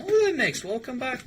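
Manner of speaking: high, loud excited hello; excited greeting. Real, not acted. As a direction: greet a returning friend, thrilled. Excited greeting to returning friend.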